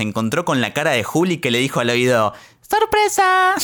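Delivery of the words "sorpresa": mock surprise. Juli surprises the guy in his ear.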